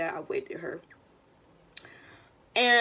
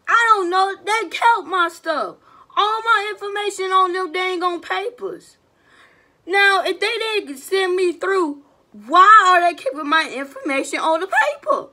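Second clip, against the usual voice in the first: loud, high indignant complaining; annoyed rant; 7 semitones higher, 6 dB louder than usual. Real, not acted. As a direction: vent the frustration loudly. Ranting about kept papers.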